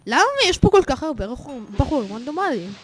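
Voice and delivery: ordinary talk, nothing marked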